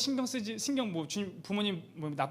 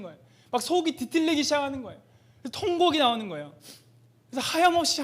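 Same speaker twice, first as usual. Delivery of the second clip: rising, louder, tear-choked sermon voice; impassioned preaching; 4 semitones higher, 5 dB louder than usual. Acted. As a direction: preach it as if the grief is coming back. Recounts weeping before God. (격정적인 설교)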